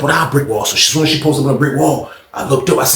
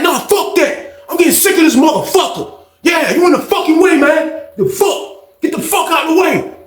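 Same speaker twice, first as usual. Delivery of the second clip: high furious yelling; angry rant; 13 semitones higher than usual. Retold or imitated. Old head ranting at OG, high angry yell. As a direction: lose it and shout him down.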